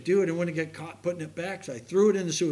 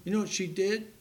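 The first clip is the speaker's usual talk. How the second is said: ordinary talk, nothing marked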